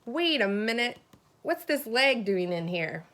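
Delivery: normal talking voice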